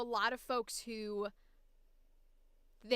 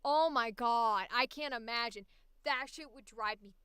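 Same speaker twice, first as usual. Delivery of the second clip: ordinary talk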